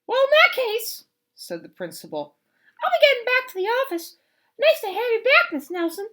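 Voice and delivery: high, loud, fast fussy principal; principal's voice. Acted. Principal leaving for the office, high and fast. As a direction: rattle it off like a busy principal.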